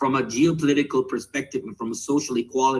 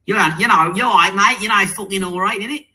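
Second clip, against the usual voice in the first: louder, higher South London British accent; 7 semitones higher, 6 dB louder than usual. Shown as a demonstration. Showing the host a British accent he doesn't have, South London accent. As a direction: put on a cocky-lad South London accent, pitched up. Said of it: "that was a very specific British accent".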